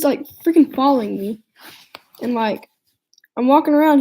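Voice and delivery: usual speaking voice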